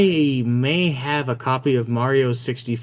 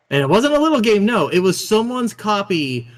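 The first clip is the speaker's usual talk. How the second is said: outraged; 8 semitones higher, 4 dB louder than usual. Real, not acted. Outraged about pawned Wind Waker.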